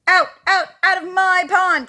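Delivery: high, loud, punched shouts; angry cry. Acted. Mister Duck throwing the animals out, high angry shout. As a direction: order them out at full voice. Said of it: "cried Mister Duck".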